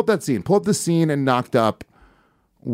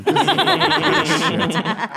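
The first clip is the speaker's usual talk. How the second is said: laughing. Real, not acted. Laughing at the sexy music.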